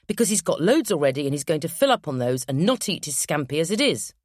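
ordinary delivery, nothing marked

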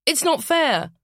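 put-on high, loud childish wail; whiny child. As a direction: wail it like a sulking kid. Child protests.

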